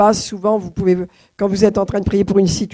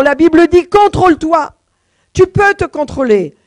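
higher, louder preaching, driving home control; full-force preaching; 4 semitones higher, 5 dB louder than usual. Acted. Preacher commanding the church to control anger, fervent. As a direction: proclaim it like a command from the pulpit.

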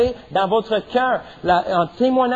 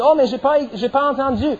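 excuse d'enfant; 7 semitones higher than usual. Retold or imitated. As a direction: excuse plaintive, plus haute et forte. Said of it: "on dit". (enfant qui proteste qu'il n'a pas entendu, voix aiguë)

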